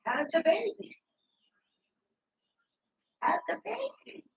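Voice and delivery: high sing-song cooing to a little one. Real, not acted. Talking sweetly to the little girl in high baby talk.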